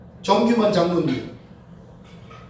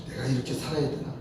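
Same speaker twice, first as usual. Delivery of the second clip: low, hushed self-questioning; weary soul-searching; 8 semitones lower, 10 dB quieter than usual. Retold or imitated. Father coming to after three lost years, weary soul-searching. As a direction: murmur it low to yourself.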